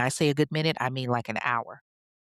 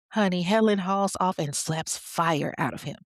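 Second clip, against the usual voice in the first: normal talking voice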